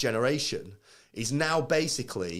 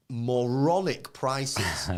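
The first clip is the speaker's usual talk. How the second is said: moronic prices stretched out slowly; drawn-out scorn; much slower than usual. Real, not acted. Mocking the high prices.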